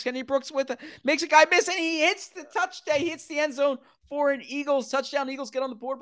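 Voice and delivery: high-pitched live play-by-play; excited touchdown call. Real, not acted. Announcer calling an Eagles touchdown, excited. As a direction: voice climbs as the runner breaks free. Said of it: "looks like that excitement was".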